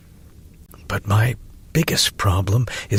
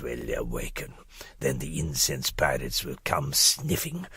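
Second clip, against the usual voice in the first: softer, secretive mad-scholar voice reading his letter; eccentric doctor's letter; 9 dB quieter than usual. Acted. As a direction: play a conspiratorial old professor, dropping to whispers. Doctor's eccentric letter read aloud.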